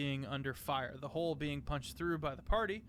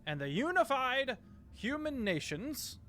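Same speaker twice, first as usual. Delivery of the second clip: booming ambassador; 6 semitones higher, 4 dB louder than usual. Acted. Ambassador denouncing Drogar before the council, booming. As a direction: boom it out like a decree. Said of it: "voice becoming much more booming".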